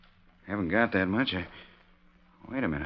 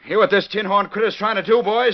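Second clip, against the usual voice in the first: high, loud call to the room; calling out to crowd; 8 semitones higher, 3 dB louder than usual. Acted. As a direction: rouse the boys against the cheat. Rousing the saloon crowd.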